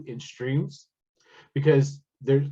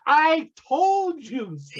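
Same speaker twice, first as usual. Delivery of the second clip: slow, high, gloating sing-song; smug told-you-so; 12 semitones higher, 4 dB louder, much slower than usual. Retold or imitated. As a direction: drag it out like a smug know-it-all. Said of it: "They go".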